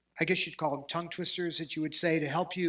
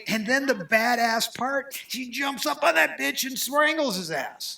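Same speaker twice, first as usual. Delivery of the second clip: excited; 8 semitones higher, 5 dB louder, slower than usual. Real, not acted. Gleeful retelling of the kill.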